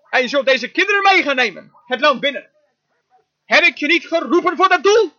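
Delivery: booming, challenging the doubter; forceful preaching. Acted. Preacher giving God's answer to Moses, forceful. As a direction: preach it as God's rebuke, strong and loud.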